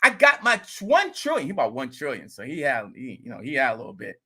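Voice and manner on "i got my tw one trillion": higher, louder excited neighbor; bragging about his coin, pumped up; imitating the neighbor. Neighbor bragging to the vlogger about his coin, excited higher voice. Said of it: "He like".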